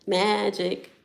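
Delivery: ordinary delivery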